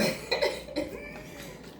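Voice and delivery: high-pitched soft giggle. Real, not acted. Laughs at mother.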